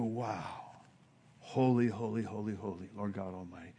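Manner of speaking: soft, low, breathless wonder; hushed awe. Acted. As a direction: whisper it in awe before the throne. Angels in awe, hushed worship.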